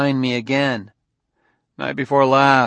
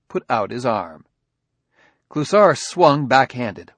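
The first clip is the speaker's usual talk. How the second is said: plain reading voice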